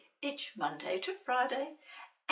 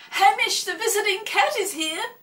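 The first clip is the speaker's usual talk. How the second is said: loud, high happy cheer; cheering crowd; 11 semitones higher, 13 dB louder than usual. Acted. The library visitors greet Hamish with a loud excited cheer. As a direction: cheer his arrival like a delighted crowd. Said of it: "they let out a cheer".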